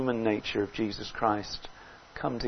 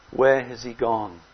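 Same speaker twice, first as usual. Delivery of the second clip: ordinary talk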